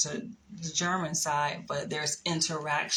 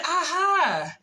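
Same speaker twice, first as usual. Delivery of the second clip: high, loud aha, then drawn out; playful I-knew-it exclamation; triumphant gotcha; 11 semitones higher, 6 dB louder than usual. Retold or imitated. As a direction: pounce on it as if caught out. Retells catching his French accent.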